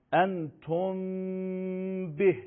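«انتم به» with a long hummed m before the b; hidden mim. Shown as a demonstration. Teacher giving an ikhfa example, mim drawn out before ba. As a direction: hold the m of «انتم», then release a short «به».

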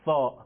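"سا" with tongue tip between front teeth, pressed gently; lisped soft th on "سا"; soft Arabic tha. Shown as a demonstration. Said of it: "خیلی نرم".

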